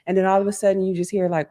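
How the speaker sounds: ordinary talk, nothing marked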